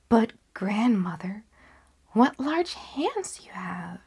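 slow hushed whisper rising on the question; timid whisper. Acted. Girl whispering her doubts to the wolf, slow and hushed. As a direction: ask it under your breath, wary.

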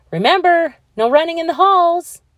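high, loud call over a crowd; teacher calling out. Acted. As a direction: call a warning across the hall.

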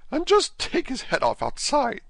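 very high-pitched queenly command; piercing character voice for a bossy Queen; imperious Queen. Acted. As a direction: order it in a high, put-on Queen voice. Queen voiced, ordering an execution.

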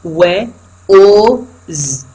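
strong "was" split into و، أو، ز with gaps; sound by sound. Shown as a demonstration. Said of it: "هذا السترونج فورم".

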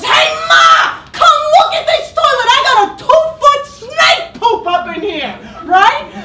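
loud, high hollering daughter; mock holler. Mother retells her daughter calling her over, loud laughing holler. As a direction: holler across the house like a daughter. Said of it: "she hollers".

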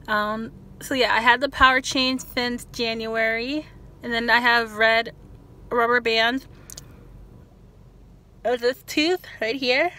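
talking with lip held open; lip pulled by finger. Real, not acted. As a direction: finger stretching mouth corner. Seen 0: Fingers pull lip corner and lower lip aside, showing braces.

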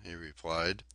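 ordinary delivery

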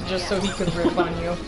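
usual speaking voice

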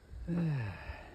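long sigh starting high; falling sigh. Real, not acted. Handler who can't flip the container, falling sigh. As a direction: sigh it out, dropping in pitch.

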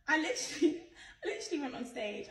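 laughing in disbelief. Real, not acted. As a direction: laugh through the words. Laughing at a rumour.

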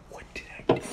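barely audible, voiceless whisper; whispered question. Real, not acted. Puzzling over what just happened, whispered question. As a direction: whisper it to yourself. Muttering to self over the game.

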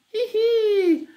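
very high, drawn-out hee-hee; acted squeaky titter of glee; gleeful giggle. A narrator's reading. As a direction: giggle like a mischievous little imp. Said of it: "cieszył się cudaczek".

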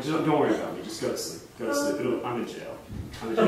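put-on soft, mostly low drunk rambling; wasted man. Drunk friend sending the caller to bed, mumbled and wasted. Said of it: "He's just kind of, like, speaking nonsense".

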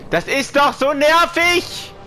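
high, slow, loud raging yell; "nervig" yelled high and drawn out; berserk rage. Real, not acted. Raging repeat of complaint.

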